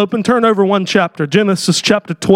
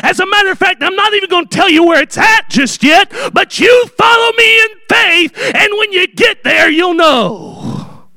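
preaching God's voice; 10 semitones higher than usual. Acted. Preacher voicing God calling Abraham, full preaching force.

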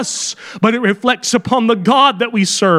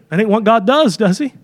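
ordinary talk, nothing marked

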